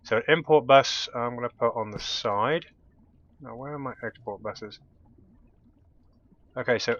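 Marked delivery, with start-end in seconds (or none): none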